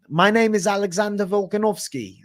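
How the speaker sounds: high-pitched attempted Australian accent; Alexander Volkanovski. Shown as a demonstration. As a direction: introduce yourself in a hammed-up Australian accent.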